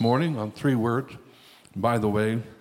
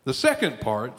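ordinary talk, nothing marked

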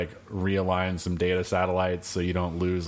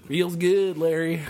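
higher, louder cartoonish delivery; goofy catchphrase voice; 9 semitones higher, 4 dB louder, slower than usual. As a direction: belt it like a TV catchphrase line. Quotes the phrase as a catchphrase.

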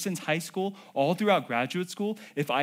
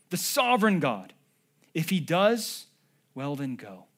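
usual speaking voice

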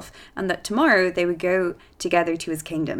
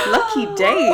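excited squeal; 14 semitones higher, 6 dB louder than usual. Real, not acted. Reacts to prince reveal.

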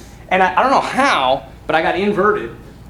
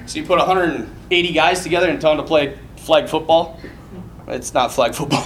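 ordinary delivery

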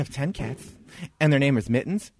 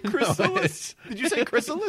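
high mocking disbelief; incredulous; 8 semitones higher than usual. Real, not acted. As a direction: pitch up in mock surprise. Mocking friend's odd word.

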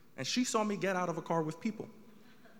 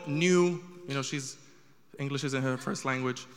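ordinary delivery

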